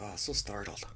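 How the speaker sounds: soft mock-scared whisper; hushed, hammed-up fright; startled parody. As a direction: whisper it like found-footage horror. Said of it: "when they're making fun of Cloverfield".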